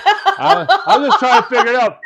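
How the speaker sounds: high giggling words, laughing through. Real, not acted. Puzzling over the bucket list, laughing.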